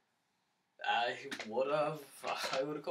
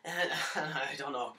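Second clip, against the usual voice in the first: ordinary talk, nothing marked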